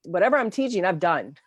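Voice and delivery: ordinary talk, nothing marked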